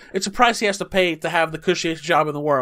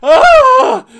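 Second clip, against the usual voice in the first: much higher, loud anguished cry; anguished wail; 12 dB louder than usual. Real, not acted. Reacting to the horrific scene, loud anguished wail. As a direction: let out a pained wail.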